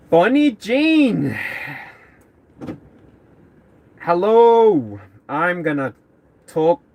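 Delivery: high, loud, drawn-out greeting in a mock Celtic accent; playful Celtic accent. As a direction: call out with a hint of Irish or Scottish accent. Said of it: "some kind of weird accent".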